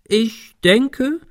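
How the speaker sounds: high, drawn-out mock deliberation; hammed-up thoughtfulness; exaggerated. A narrator's reading. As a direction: overdo the pondering, pitch way up. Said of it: "fuhr er übertrieben fort".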